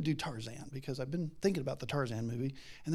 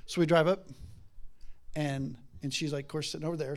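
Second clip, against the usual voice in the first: ordinary talk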